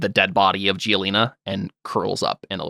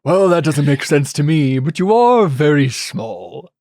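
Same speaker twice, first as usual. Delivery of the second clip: character voice; 4 semitones higher, 4 dB louder, slower than usual. Acted. Character voice addressing player.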